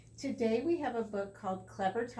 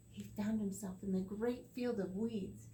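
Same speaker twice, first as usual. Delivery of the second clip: ordinary delivery, nothing marked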